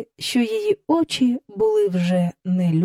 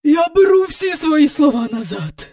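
forced out; 8 semitones higher, 4 dB louder than usual. A narrator's reading. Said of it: "видушила з себе міс Ендрю".

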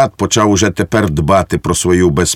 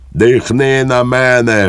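higher, drawn-out mock shriek; shrieked order; 5 semitones higher, slower than usual. A narrator's reading. Lieutenant orders Švejk to breathe on him, mock-shrieking. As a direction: stretch the order out on a raised, held pitch. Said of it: "вереснув підпоручник Дуб".

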